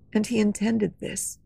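ordinary delivery

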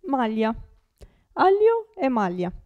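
ordinary talk

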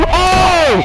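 loud, high cry as something jumps out; startled yell. Real, not acted. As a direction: yelp when the monster appears. Startled by a jump scare.

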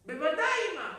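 loud, high, instant agreement; over-willing shout to mother; eager son. Acted. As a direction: jump to it with a booming reply. Son eagerly agrees to fetch water.

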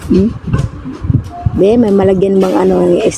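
ordinary talk

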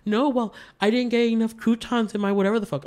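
mocking girlfriend. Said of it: "She's like".

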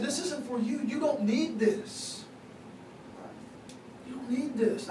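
gentle correction. Retold or imitated. God as daddy correcting his son, gentle correction. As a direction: gently imitate a loving father, drawing it out.

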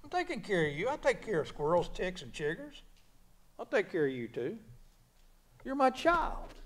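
hushed, lightly voiced God; the Lord speaking. Preacher voicing the Lord to a worrier, soft mock reply. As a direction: softly reassure a child, imitating God. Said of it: "the Lord wants to say".

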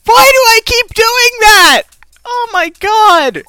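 high, slow, loud wail; exasperated yelling. Real, not acted. Gamer repeating a mistake, exasperated yelling. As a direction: stretch the words out in self-directed frustration.

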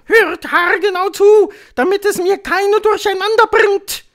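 shrill, very loud order; scolding witch. A narrator's reading. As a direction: order them about in a screech. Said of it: "fuhr sie die Hoch". (herrische Hexe, schrill und sehr laut)

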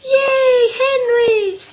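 very high, loud teasing cheer; yelling cheer. A narrator's reading. Big boys cheer their schoolmate, yelling. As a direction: holler like boys at a window. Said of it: "yelled some big boys".

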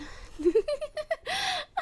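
shrieking giggle falling away; unhinged high laugh. Acted. Captor laughs at waking captive, high and unhinged. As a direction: laugh like you can't contain the thrill.

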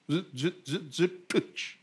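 quick mock sound effect. Preacher mimicking David's sling, soft and quick.

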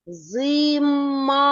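Зима with a long, sustained final А; last sound drawn out. Shown as a demonstration. Teacher cueing the next sound, final А stretched in Зима. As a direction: hold the А at the end of Зима on an even note. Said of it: "Який звук є наступним?".

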